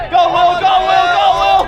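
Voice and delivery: loud sideline shouting; frat bro. Real, not acted. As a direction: chant it hard across the field. Sideline cheering during play.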